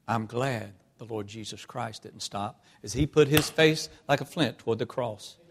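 ordinary delivery

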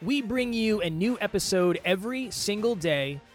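upbeat podcast promo read; life coach. Acted. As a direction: pitch the show like an ad. Show intro pitch read.

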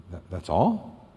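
low, very soft incredulous question; hushed disbelief. Acted. Rescued man questioning the simple stick instruction, hushed disbelief. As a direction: ask it softly, hesitantly, in mock disbelief.

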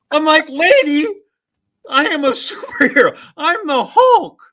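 hammed-up high, loud indignant yell; outraged kid protesting at full volume; pissed off. Kid in Hulk costume to the lady, mock-furious protest. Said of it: "I was so pissed".

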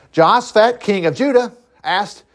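ordinary talk, nothing marked

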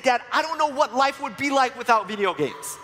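high, eager boy's voice, imitated; imitating an excited son, pitched high; excited kid. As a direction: play the son gushing about his games. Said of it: "Francis was really excited".

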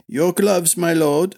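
high, frosty formality. A narrator's reading. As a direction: offer it with icy courtesy. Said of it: "said Cower frostily".

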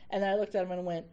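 usual speaking voice

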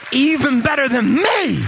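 loud high emphatic cry; shouted promise. Retold or imitated. Jesus shouting to his disciples that greater things are coming. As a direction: belt out the promise.

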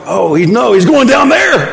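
imitated parents' dismay, rising high and loud; mock alarm. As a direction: build to a high, loud, comic punchline. Imitates alarmed parents, climbing to punchline.

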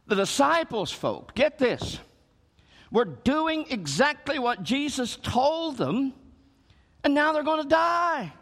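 rising urgency. Real, not acted. Preacher building to climax.

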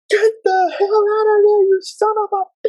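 very high crying shout; high tearful furious yell; screaming. Retold or imitated. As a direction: scream in panic, voice cracking. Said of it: "And I said".

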